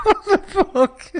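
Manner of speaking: loud, high, slow laughing disbelief; laughing shout. Real, not acted. Reacting to his friend's dark joke, loud laughing shout. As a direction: shriek it through laughter.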